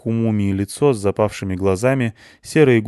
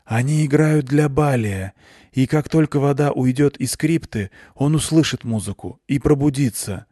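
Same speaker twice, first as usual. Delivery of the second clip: eerie solemn; 5 semitones higher than usual. A narrator's reading. Sumerla tells Anton the music wakes Baliy, eerie. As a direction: speak like a spirit reciting doom.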